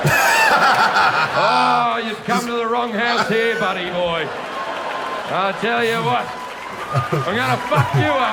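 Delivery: loud, swaggering threat to an intruder; mock tough guy threat. Comic homeowner threatening a burglar, loud hammed-up swagger.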